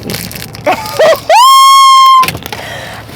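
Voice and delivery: loud, high shriek of laughter; a piercing laughing shriek. Real, not acted.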